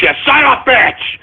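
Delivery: high-pitched exasperated curse; angry outburst. Real, not acted. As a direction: let the curse burst out high. Host curses again, higher.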